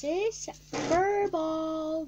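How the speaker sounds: slow, high, loud presentation; announcing. Real, not acted. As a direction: announce it slowly. Presenting toy grandly.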